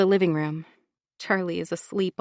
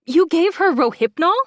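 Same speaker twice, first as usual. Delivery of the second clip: high, loud horrified question; horrified disbelief; 9 semitones higher, 8 dB louder than usual. Acted. Laura horrified at what Bronwyn gave her daughter. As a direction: gasp it out in disbelief.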